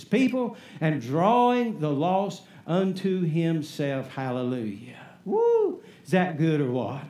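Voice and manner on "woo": sudden high whoop of praise; joyful whoop. Real, not acted. Preacher whooping to stir the congregation, high excited whoop. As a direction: let out a high whoop for the Lord.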